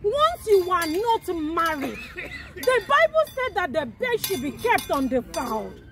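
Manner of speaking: preaching. Acted. Preaches about promises.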